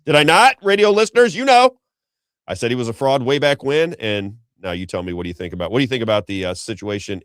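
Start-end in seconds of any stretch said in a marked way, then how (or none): 0.0-1.8 host rallying his radio listeners, getting higher and louder; rising emphatic call-out. Real, not acted.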